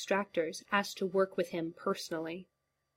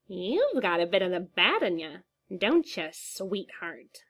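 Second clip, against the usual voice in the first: swooping sing-song, wrong syllables stretched; creepy clown croon; sing-song Joker voice. Acted. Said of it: "His voice was strange, shivering up and down in a sing-song".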